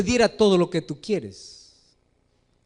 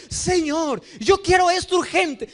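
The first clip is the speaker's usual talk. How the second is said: demanding believer; 6 semitones higher than usual. Retold or imitated. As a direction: demand it from God like a spoiled believer. Believer demands answer from God.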